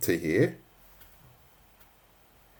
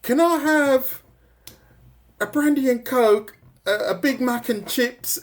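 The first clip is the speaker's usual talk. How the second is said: mock greedy order; 14 semitones higher, 4 dB louder than usual. High mock greedy Wendy ordering everything. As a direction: stretch it out like ordering the whole menu. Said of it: "And then she goes".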